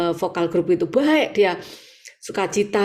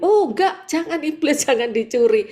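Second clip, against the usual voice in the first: high playful protest at the devil; mock protest; 6 semitones higher than usual. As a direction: cry out in mock alarm. (protes main-main dengan nada tinggi)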